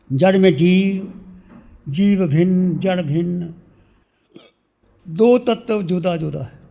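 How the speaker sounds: measured meditation voice with long pauses, softening as it goes; guided meditation. Real, not acted. Teacher leading seated devotees into inner silence, guided meditation. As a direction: guide listeners inward, phrase by phrase, letting endings fade.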